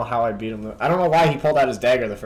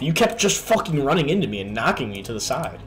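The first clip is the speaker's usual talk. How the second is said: ordinary talk, nothing marked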